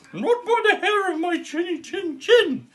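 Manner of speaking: high squeaky little pig, laughing; high-pitched pig refusing the wolf through a giggle. Acted.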